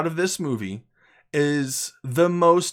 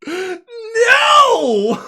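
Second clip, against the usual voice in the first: screaming what no, much higher and louder; shocked shout; 12 dB louder than usual. Retold or imitated. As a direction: cry out in disbelief from the seat. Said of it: "I jumped up in my seat. I'm like".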